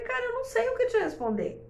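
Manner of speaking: voz de mãe perdida bem mais aguda; imitando a si mesma. Retold or imitated. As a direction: diga alto e perdido, admitindo que não sabe. (mãe confessa à filha que não sabe responder, voz aguda)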